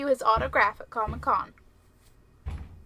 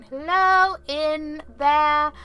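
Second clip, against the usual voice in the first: slow, raised, loud call; mysterious answering voice; 5 semitones higher, 10 dB louder, much slower than usual. Acted. Imagining a voice answering in a creepy house, drawn-out call. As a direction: draw the words out like an eerie reply. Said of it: "hear a voice going".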